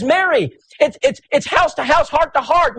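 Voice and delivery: high, eager voice stumbling over its words; gleeful, tripping rush; excited stammer. Retold or imitated. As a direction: burst out with delighted surprise. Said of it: "He was so excited to meet".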